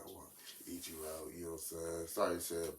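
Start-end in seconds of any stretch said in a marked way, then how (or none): 0.1-1.3 soft, low-volume mock seduction; retold coaxing. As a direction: imitate quietly propositioning a partner. Retold sexual coaxing of partner.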